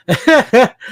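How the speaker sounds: high loud laugh; burst of laughter. Real, not acted. Laughs at his own story.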